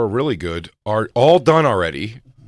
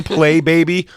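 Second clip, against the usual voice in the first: louder, higher pleading yell; mock crying dad; 8 semitones higher, 8 dB louder than usual. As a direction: urge the kid on through tears, hammed-up. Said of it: "your dad's crying".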